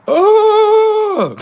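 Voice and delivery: a loud, very high mechanical moan cry; hammed-up high-pitched robot moan; mock robot moan. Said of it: "they start moaning like robots".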